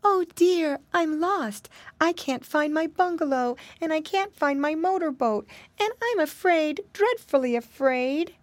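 put-on high, plaintive little-girl voice; gentle crying voice. A narrator's reading. Lost little girl alone in the woods, gently crying. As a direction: wail it gently, lost and frightened. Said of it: "someone crying and a voice".

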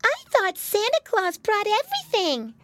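put-on tiny, very high child voice; baby pig. A narrator's reading. Baby pig questioning mama about Santa, puzzled squeak.

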